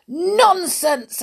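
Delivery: high, loud indignant exclamation; exclaimed dismissal. Acted. Stubborn listener dismissing Miss Sunshine's tale, exclaiming. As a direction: exclaim it, brushing the tale aside. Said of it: "exclaimed little Miss Stubborn".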